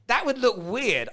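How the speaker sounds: usual speaking voice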